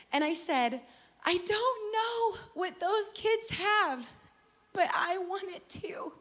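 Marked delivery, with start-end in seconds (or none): 1.2-6.1 high, slow, drawn-out mock wail; high, crying-voice imitation; retold tearful plea. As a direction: imitate a child blurting through tears. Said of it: "I burst into tears".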